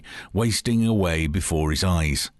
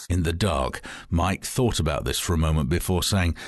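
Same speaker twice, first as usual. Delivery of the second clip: ordinary talk, nothing marked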